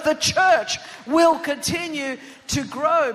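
loud, declamatory preacher's proclamation; full-force preaching. Real, not acted. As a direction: proclaim it to the whole church at full volume.

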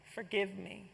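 starts high, fades to a soft plea; mild penitent cry fading away; humble plea. Acted. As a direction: beg forgiveness, ashamed. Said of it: "he cries out".

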